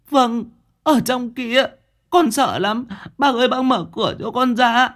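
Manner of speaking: put-on very high, urgent child's plea; frightened little boy. A narrator's reading. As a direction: beg to be let out in a scared child voice. Frightened boy pleads to get out.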